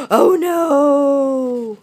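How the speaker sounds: gasp, then a high loud wail; shocked gasp. Acted. Narrator as tiger tumbles in, gasping alarm. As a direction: gasp and stretch out the dismay.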